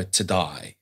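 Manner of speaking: "die" for "day", the A turned into an I; "to die", slow, with an eye vowel where "day" would be. Shown as a demonstration. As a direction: say "to die" slowly, meaning "today". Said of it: "Anything that's A, he says, "I".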